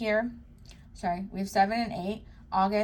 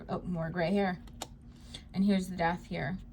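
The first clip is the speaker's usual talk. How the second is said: ordinary talk, nothing marked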